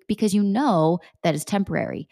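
usual speaking voice

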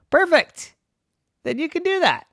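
very high, loud, sing-out reply; delighted approval. Acted. Ghost answers the captain's protest, high delighted approval. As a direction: exclaim it up high as if that settles it.

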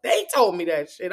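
usual speaking voice

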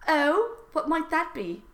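high, mock-innocent questioner; coy interviewer. Voices coy interviewer in fan fiction.

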